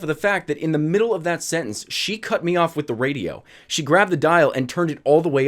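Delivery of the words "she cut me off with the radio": ordinary delivery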